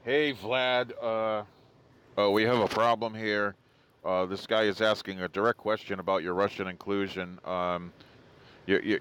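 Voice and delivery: low, hesitant interpreter in the earpiece; discreet translator. Pretend. Translator coaching Vlad past a question about collusion, low and hesitant. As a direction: confidential low monotone, feeling out the words. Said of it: "Translator would have been like".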